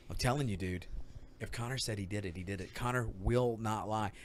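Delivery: low, very soft retold insistence; hushed earnest assurance. Dad vouching for Connor to Will, hushed retelling. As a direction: assure him quietly and gravely.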